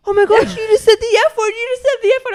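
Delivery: put-on scandalised kid. Boy accusing her of saying the F word, high loud fast squeal. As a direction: squeal it like a shocked child.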